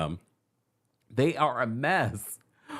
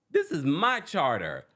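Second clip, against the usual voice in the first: mock defiant guest; 7 semitones higher, slower than usual. Drunk guest defying captain.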